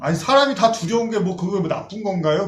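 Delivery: congregant's objection. Retold or imitated. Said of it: "그러면".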